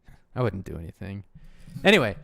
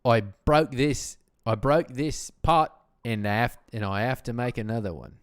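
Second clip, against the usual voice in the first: Australian accent, bursting in loud then easing off; 4 dB louder than usual. Shown as a demonstration. Retrying the broken-pot line on request, Australian accent. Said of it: "Can you do an Australian accent?".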